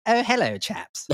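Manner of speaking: high, loud posh English greeting fading to a breathy whisper; a toff greeting his mates, very upper-class English; plummy British upper-class voice, high then hushed; posh accent. Retold or imitated. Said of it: "with a very posh accent".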